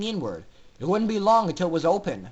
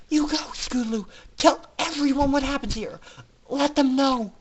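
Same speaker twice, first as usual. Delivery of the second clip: higher, lightly voiced brave goodbye; tearful farewell; 7 semitones higher than usual. A narrator's reading. Aurora sending Scootaloo away to warn everyone, tearful. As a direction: urge her friend on, accepting fate.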